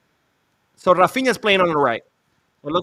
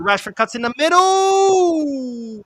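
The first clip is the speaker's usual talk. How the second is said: high, loud, drawn-out play call; excited call; 11 semitones higher, 6 dB louder, much slower than usual. Real, not acted. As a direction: opgewonden commentaar, luid.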